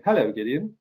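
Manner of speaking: greeting in a put-on British accent; a light mock-British hello. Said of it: "into my British accent".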